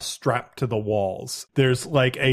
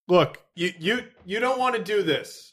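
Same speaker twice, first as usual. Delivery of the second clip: nervous suspect; 8 semitones higher than usual. Acted.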